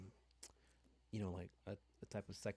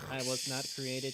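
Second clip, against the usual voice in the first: louder, alarmed robot voice; panicked machine; 6 dB louder than usual. Pretend. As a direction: plead like a broken machine. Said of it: "The machine's like".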